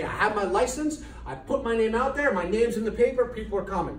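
smug naive quote. Retold or imitated. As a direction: sound self-satisfied and sure. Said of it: "most people will sit there and say".

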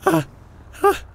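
high, loud panicked ahs; fleeing, high and loud panic; panicked cries. Acted. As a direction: yelp in rising panic then call wait.

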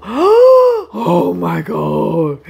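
slow, breathless awe; awed gasp. Retold or imitated. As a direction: let it out slowly, amazed. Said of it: "make you go".

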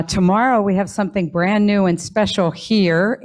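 usual speaking voice

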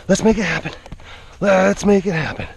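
ordinary talk

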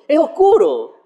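"oscuro" tossed up high, with a laugh; harmless sense. Shown as a demonstration. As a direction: say "oscuro" as a light, innocent description. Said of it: "no significa que sea oscuro".

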